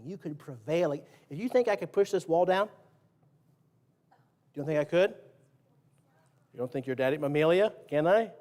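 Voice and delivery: ordinary talk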